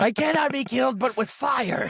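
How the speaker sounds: high-pitched mock ranting; hammed-up, high defiant shout; retold defiant yell. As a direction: cry it out like someone being dragged away. Retold man being wrestled off screen.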